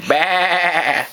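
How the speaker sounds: a mock goat bah; goat bleat. Shown as a demonstration. Parent modelling goat sound for toddler, mock bleat.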